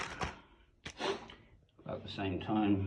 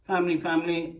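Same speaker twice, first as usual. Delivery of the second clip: family, family lilted high and louder; higher, louder fond repeat of family; sing-song family; 9 semitones higher, 8 dB louder, faster than usual. Real, not acted. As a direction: lift both family up in a fond singsong. Naming photo, repeated louder.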